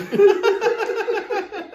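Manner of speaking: loud, high burst of laughter. Real, not acted. Big laugh after burping.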